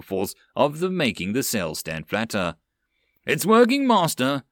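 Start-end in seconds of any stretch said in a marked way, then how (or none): 3.2-4.4 high, loud happy yell; excited yell. Acted. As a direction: shout the good news. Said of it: "the first mate on the deck yelled".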